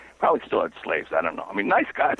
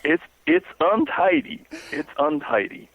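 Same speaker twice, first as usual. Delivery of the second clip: put-on Southern accent. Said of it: "he had a Southern accent".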